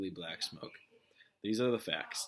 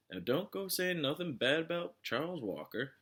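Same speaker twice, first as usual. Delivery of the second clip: sheriff voice; 8 semitones higher than usual. A narrator's reading. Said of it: "said the sheriff".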